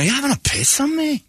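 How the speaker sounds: put-on high, botched Scottish accent; a bad try at the Glasgow man's line; mangled Scottish phrase. Host garbling the Scot's phrase, in a high botched Scottish accent. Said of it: "I don't think you're even doing that right".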